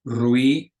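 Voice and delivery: ruido glided into one syllable, rui-do; diphthong. Shown as a demonstration. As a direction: slur ruido's vowels together. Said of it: "It's not ruido, it's".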